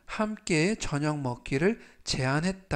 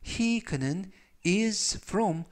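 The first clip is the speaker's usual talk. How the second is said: slow reading; 4 semitones higher, much slower than usual. Shown as a demonstration. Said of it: "활용 문장 보시겠습니다".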